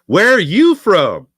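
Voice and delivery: high, loud, slow curious question; quoting a local. Retold or imitated. Someone in a Nashville bar asking the host where he's from, loud and slow. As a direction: ask it like a puzzled Nashville local. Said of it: "somebody said".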